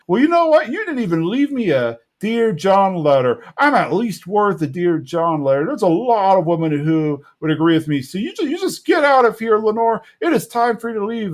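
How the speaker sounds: high-pitched mock scolding; indignant Bill. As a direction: start high and offended, dismiss firmly. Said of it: "Bill kinda snaps back to it".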